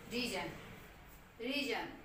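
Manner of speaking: रीजन with a long री, not रे; रीजन said ree-zun; long ee in reason. Shown as a demonstration. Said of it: "री बोल रहे हैं, रे नहीं बोल रहे".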